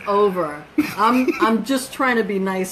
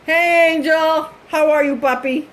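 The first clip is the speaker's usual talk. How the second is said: sweet greeting; 9 semitones higher, 5 dB louder than usual. Real, not acted. Mom greets viewer sweetly.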